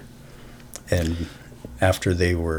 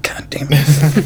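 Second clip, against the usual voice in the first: mock curse; 8 semitones higher, 6 dB louder, slower than usual. Acted curse after razor cut.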